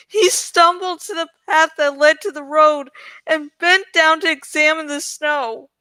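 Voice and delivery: lively read-aloud storytelling; story narration. A narrator's reading. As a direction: narrate the adventure with drama. Reads novel aloud.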